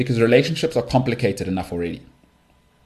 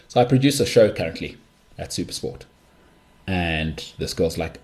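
ordinary talk, nothing marked

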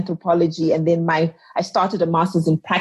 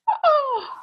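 quick high intake of breath in astonishment; a sudden stunned gasp; sharp gasp. Retold or imitated. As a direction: gasp as if hit by the idea. Retelling stunned first lecture.

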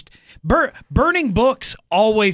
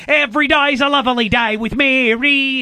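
mock Cockney accent; 9 semitones higher, 6 dB louder than usual. Kevin Costner tries a fake Cockney accent for the director.

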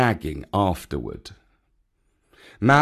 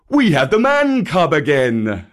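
louder, higher bear voice with emphasis; Baloo; 4 semitones higher, 6 dB louder than usual. Acted. Baloo on getting the man cub back, loud and raised. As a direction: lift it and declare it loudly.

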